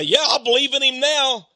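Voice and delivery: high, loud, laughing plea for another chance; mock the excuse of someone begging too late; half-amused imitation of a desperate believer; pleading latecomer. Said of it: "multitudes who say".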